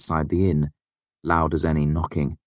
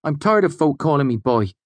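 higher, louder snap; an angry young man's higher retort; snapped; 10 semitones higher, 4 dB louder than usual. A narrator's reading. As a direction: snap back, stung and proud. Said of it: "the smith's prentice snapped".

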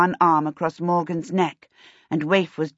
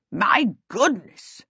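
slow, swooping exclamation; amazed king; slower than usual. A narrator's reading. King learning where the money went, slow amazement. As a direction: marvel it slowly.

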